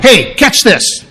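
high, slow call for attention; attention-grabbing preacher. Acted. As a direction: grab the congregation's attention. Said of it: "if I was preaching and I said".

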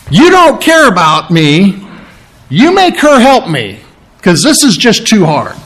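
high, exasperated complaint; acted-out frustrated protest; end of her rope. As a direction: plead like someone fed up and overworked. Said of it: "she's finally come to the end of the rope".

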